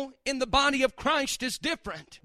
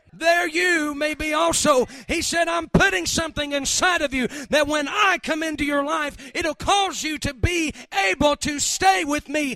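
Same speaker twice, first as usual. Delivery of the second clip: loud, fervent preaching; preaching at full force; 3 semitones higher, 5 dB louder than usual. Real, not acted. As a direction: proclaim it to the congregation.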